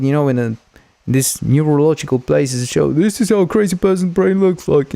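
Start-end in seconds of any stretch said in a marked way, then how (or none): 2.9-4.9 high put-on announcer voice for a joke; mock exhibit voice. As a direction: present it like a museum guide.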